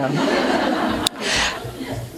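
a short breathy chuckle; real little laugh at the joke. Real, not acted. Author laughs after reading.

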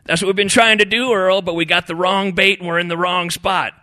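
high, loud complaint; griping. A narrator's reading. Fishing buddy complains about bait and spot, griping. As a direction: gripe it out loudly.

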